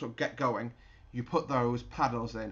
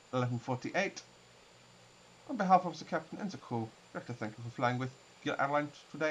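raised, smooth cabin PA voice; airline captain announcement; 3 semitones higher than usual. Acted. Captain to passengers arriving in Edinburgh, polished PA announcement. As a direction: welcome the passengers like a captain on the intercom.

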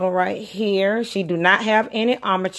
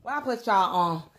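usual speaking voice

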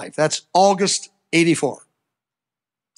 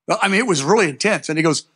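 ordinary talk, nothing marked

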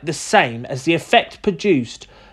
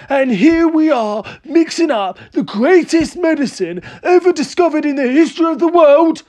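excited crying out; 9 semitones higher than usual. A narrator's reading. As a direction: rant grandly in a high, overexcited voice. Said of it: "cried Mister Cranky".

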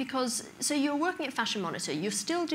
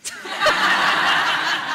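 loud high laugh; 11 dB louder than usual. Real, not acted. Interviewer laughing hard at her Canada joke.

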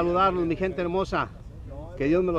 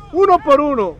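loud, high score call; shouted score; 9 semitones higher, 12 dB louder than usual. Real, not acted.

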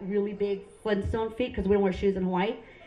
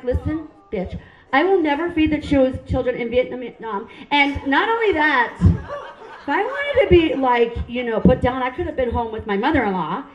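loud mock-indignant retort; indignant comeback; 2 semitones higher, 5 dB louder than usual. Insulted client telling off the nail lady, indignant.

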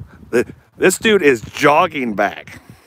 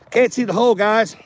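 exasperated call-out; 8 semitones higher than usual. Real, not acted. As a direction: throw the frustration out to the audience. Struggling to fit a screw.

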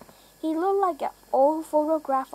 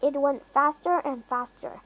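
ordinary delivery

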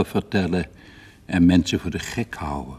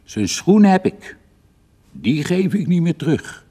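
triumphant; 8 semitones higher than usual. A narrator's reading. Father boasts over captured boot.

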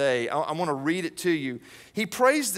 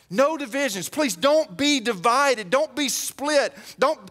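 earnest plea; 6 semitones higher than usual. Real, not acted. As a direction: beg them not to split. Preacher pleads with the church.

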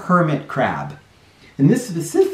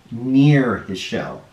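slow, leaning on "Near"; stress on near; slower than usual. Shown as a demonstration. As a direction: draw out "Near his shell" to mark it. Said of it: "not on it".

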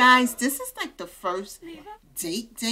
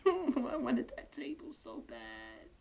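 whispered whine; 3 semitones higher, 9 dB quieter than usual. Real, not acted. Streamer whispering regret to viewers, soft laugh. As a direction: whisper it, softer, half-laughing. Whining about lost table.

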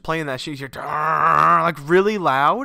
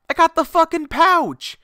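high, loud burst of delight; thrilled to show off the pouch; excited; 13 semitones higher, 6 dB louder than usual. Real, not acted. Showing viewers his matching pouch, excited.

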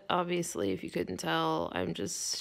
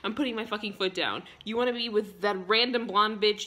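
ordinary talk

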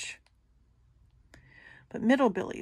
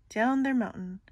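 plain reading voice

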